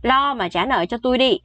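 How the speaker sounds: ordinary talk, nothing marked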